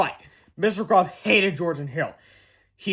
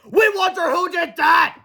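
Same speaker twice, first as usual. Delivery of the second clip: much higher, louder, slow; crowd shout; 11 semitones higher, 6 dB louder, slower than usual. Acted. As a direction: chant it as a whole crowd. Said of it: "shouted the fans".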